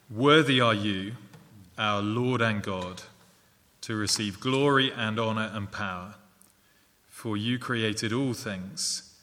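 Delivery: slow, measured verse reading; scripture reading. Real, not acted. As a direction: read it out solemnly, phrase by phrase with pauses. Reads closing verse aloud.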